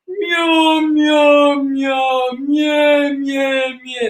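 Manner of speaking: dark meows pulled back in the throat. Shown as a demonstration. Teacher demonstrating back-placed resonance on meows. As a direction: let the meow sit far back, like a yawn. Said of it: "really far back".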